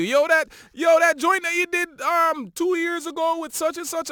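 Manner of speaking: high, loud excited fan voice; eager fan. Acted. Fan reminding the rapper of an old song, eager, high and loud. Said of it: "fans now will tell you".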